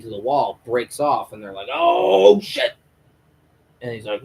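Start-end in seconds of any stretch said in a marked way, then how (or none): 1.7-2.7 much higher, loud startled yelp; startled oh shit. Acted. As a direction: jolt as the chain snaps. Prisoner breaks collar off wall.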